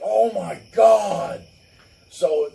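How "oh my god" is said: loud, very slow outraged yell; exasperated dad shout. Retold or imitated. Dad hearing the couple of drinks claim, loud slow shout. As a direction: explode like a fed-up father. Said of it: "he was like".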